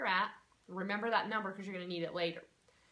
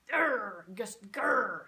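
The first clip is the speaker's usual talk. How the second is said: mock growl of frustration; 8 dB louder, much slower than usual. Shown as a demonstration. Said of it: "it's frustrating".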